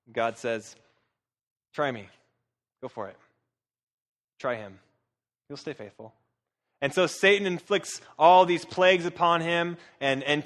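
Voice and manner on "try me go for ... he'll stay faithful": low, soft voice for God, with pauses; quiet dare. Acted. God to Satan, trusting Job will stay faithful, quiet low dare. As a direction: dare him calmly, dropping softer.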